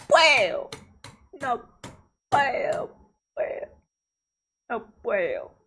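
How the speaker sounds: high mock whine; mock whining that she can't pause the video; whiny. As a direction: whimper it like a frustrated kid.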